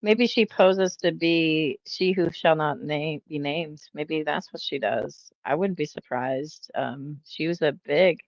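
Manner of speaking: normal talking voice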